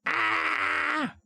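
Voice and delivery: sustained, very high-pitched scream; high mock scream. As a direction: let out a long, put-on shriek. Mock fright at the deathworm.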